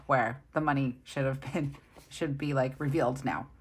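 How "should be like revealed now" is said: normal talking voice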